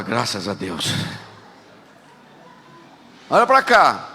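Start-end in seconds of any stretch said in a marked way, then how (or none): 0.0-0.9 relieved sigh. Retold or imitated. Relieved the child moved.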